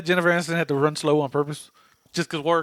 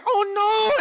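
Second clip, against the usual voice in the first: high, cartoonish "oh no" yelp; mock yelp. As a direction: yelp it like a slapstick crash. Leprechaun crash sound retold.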